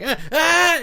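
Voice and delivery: high, loud laughing grunts while imitating a friend; squeaky mock-struggle noises; mock effort grunts. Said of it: "I just pictured you just like".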